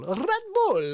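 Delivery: high, whooping product name; manic comic voice. Acted. Riffing on an energy drink, manic high whoop. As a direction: burst of cartoonish hype. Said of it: "couldn't imagine you after a can".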